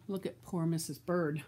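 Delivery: plain reading voice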